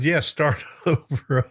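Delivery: reading a comment through a laugh; laughing quote. Retold or imitated. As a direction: chuckle your way through the line. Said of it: "He said".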